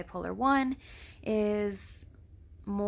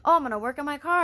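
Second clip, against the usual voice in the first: fast, higher and louder, eager; excited self-quote; 7 semitones higher, 7 dB louder, much faster than usual. Retold or imitated. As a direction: rattle it off like a giddy new plan. Quoting her own manic excitement.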